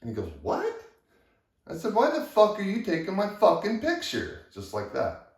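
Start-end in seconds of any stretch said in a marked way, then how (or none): 0.4-0.8 very high, loud startled what; baffled what. Retold or imitated. Superintendent baffled by the accusation, very high startled what. 1.9-4.4 high, loud cursing demand; furious demand. Retold or imitated. Worker escalating against the superintendent, high loud cursing demand.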